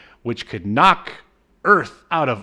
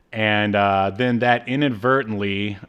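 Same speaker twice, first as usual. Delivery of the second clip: normal talking voice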